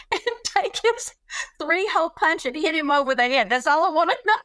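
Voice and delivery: retold question breaking into high laughter; laughing. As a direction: imitate a friend laughing through her question. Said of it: "she started laughing".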